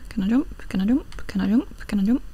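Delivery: usual speaking voice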